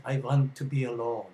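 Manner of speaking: imitating a thick Swedish accent, w said as v; Garbo's Swedish accent. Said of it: "in her wonderful thick accent".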